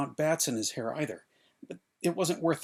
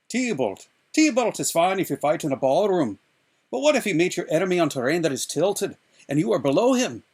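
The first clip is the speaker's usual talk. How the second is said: put-on louder, scoffing old fencing master; MacPherson speaking; 3 semitones higher, 8 dB louder than usual. A narrator's reading. MacPherson the Scot scoffing at Inigo, louder lecturing. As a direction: scold the pupil's book learning. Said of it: "who said".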